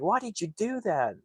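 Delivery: high-pitched retold protest; freaking out. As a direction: imitate an upset, disbelieving demand. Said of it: "He's freaking out".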